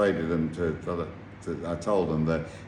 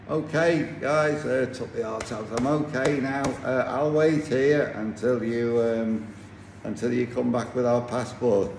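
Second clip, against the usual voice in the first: mock cheerful reassurance; 6 semitones higher than usual. Cheerful bluff to his captors.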